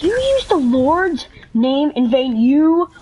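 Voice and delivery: slow, emphatic mock sermon; mock scolding. Classmate accusing her of blasphemy, hammed-up reproach. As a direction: pronounce each accusation slowly, leaning on it.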